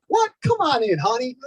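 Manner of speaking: a hint of a delighted Kentucky woman; surprised welcome. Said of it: "She's like".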